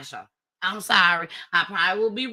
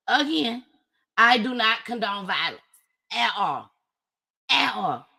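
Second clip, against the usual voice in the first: ordinary talk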